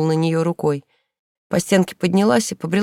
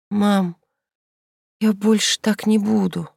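wheezing; 3 semitones higher, slower than usual. A narrator's reading. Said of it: "просипела Инка".

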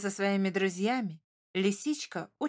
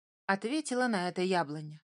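usual speaking voice

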